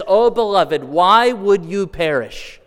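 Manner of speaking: high, loud, slow plea to the lost; pleading divine voice, slow and aching; God pleading. Acted. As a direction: let God beg them to turn back. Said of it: "he says".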